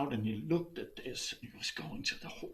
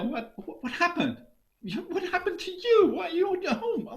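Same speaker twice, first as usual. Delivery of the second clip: much higher, laughing disbelief; startled; 5 dB louder than usual. Acted. Wife finds husband suddenly home, laughing surprise. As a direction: gasp out the questions in shock.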